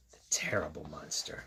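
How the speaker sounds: hushed, breathy title; spooky whisper. Acted. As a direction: whisper it like a scary secret.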